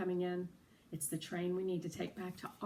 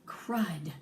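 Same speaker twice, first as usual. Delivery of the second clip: usual speaking voice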